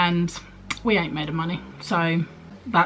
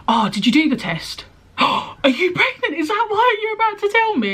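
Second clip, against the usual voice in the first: much higher, gasping, squealing with a laugh; excited disbelief; 3 dB louder than usual. Retold or imitated. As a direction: imitate a friend gasping at the news. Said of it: "And she was screaming".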